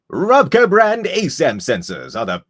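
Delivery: loud, slow, high robot salesman; Robco salesman voice. Pretend. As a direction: pitch it like a Robco salesman bot. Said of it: "Robco salesman voice".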